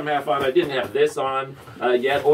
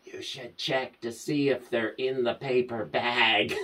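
inner divine voice; 2 semitones lower, 7 dB quieter than usual. Retold or imitated. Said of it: "I hear this".

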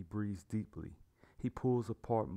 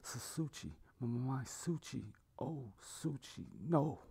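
hushed, higher-pitched acted grief; crying, low voice; 5 semitones higher, 3 dB quieter than usual. A narrator's reading. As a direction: stammer softly, grieving over your child. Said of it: "He cries and says in a low voice".

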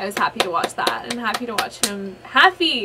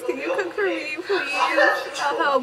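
begging while laughing; laughing plea; 4 semitones higher, slower than usual. Real, not acted. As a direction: plead through giggles.

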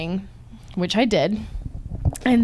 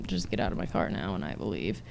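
very low, soft aside; low mutter; 14 semitones lower, 11 dB quieter, faster than usual. Retold or imitated. Woman excusing herself from an awkward date, low mutter. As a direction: drop it under your breath. Imagined reply, backing out awkwardly.

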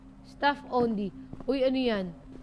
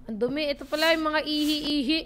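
usual speaking voice

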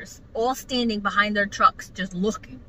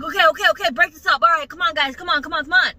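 loud, higher, urgent intervention; rapid-repeat calming orders, voice raised; manager breaking up fight; 6 semitones higher, 5 dB louder, faster than usual. Retold or imitated. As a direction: imitate a boss stepping between two fighters.

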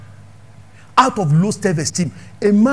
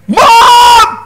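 high, loud yell for mom; shouted call; 10 dB louder than usual. Retold or imitated. Son shouting for his mother in a nightclub, loud yell. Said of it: "He said".

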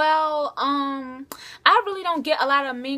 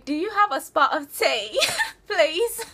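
a shaky first try at posh British; British accent; 4 semitones higher than usual. Shown as a demonstration. Answering a fan's request with a laughing British accent. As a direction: giggly attempt at a British accent. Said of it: "Can you do a British accent?".

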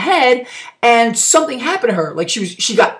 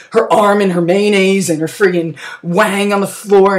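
ordinary talk

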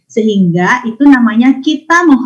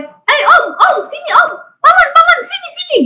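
much higher, insistent calling of an uncle; shrill child calling. Shown as a demonstration. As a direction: demo the wrong way, calling out high and eager. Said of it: "oh tidak boleh". (panggilan anak bernada sangat tinggi)

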